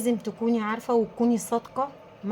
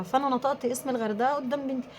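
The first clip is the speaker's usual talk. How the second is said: ordinary talk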